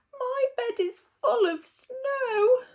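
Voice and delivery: high, slow, tearful little voice; whimpering squirrel. A narrator's reading. Squirrel telling Percy its bed is snowy, slow tearful whimper. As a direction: cry it out like a cold little squirrel. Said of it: "said the squirrel".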